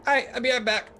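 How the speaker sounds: high little gnome voice; gnomish barmaid. Acted. Gnome barmaid heading off to fetch a drink, high gnome voice. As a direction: pipe it up high as a small barmaid. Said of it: "She goes".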